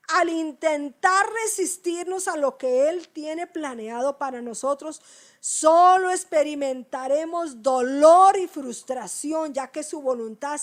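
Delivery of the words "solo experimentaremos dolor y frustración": slow and louder, weighty warning; slow loud preaching. Real, not acted. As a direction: drive home the warning slowly. Cierre enfático. (advirtiendo a la congregación, lento y fuerte)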